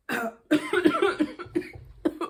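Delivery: sudden loud choking cough; real coughing fit. Real, not acted. Choking on her words mid story, loud real cough. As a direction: cough hard, caught off guard. Chokes while talking.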